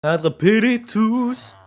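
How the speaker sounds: high, drawn-out triumphant shout; loud slogan cry. Pretend. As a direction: belt it out like a cartoon catchphrase. Slogan lancé en écho à « tous ». (slogan crié, aigu et traînant)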